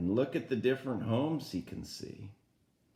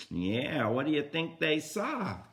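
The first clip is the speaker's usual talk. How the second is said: usual speaking voice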